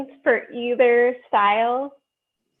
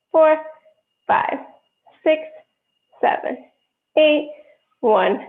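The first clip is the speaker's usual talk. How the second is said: loud, sharp counting to the beat; stern rhythmic counting; 7 dB louder, slower than usual. Acted. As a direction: call the counts like a dance teacher. Teacher counting ballet class.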